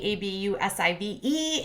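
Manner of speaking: A B U S I V E spelled letter by letter; each letter of ABUSIVE said separately, as if it cannot be said aloud. Acted.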